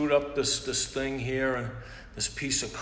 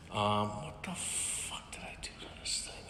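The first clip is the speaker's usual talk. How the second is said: whispered, frustrated aside; under-breath curse; 3 semitones lower, 9 dB quieter than usual. Real, not acted. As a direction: whisper it to yourself, annoyed. Muttering at broken equipment.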